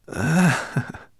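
lightly breathy dragon voice; faintly rasping dragon. A narrator's reading. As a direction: let a faint rasp into the words. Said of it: "in a voice with a faint rasp".

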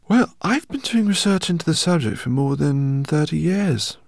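put-on high, loud, fussy old scholar; eccentric professor. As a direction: voice him as an excitable professor. Professor speaks in story.

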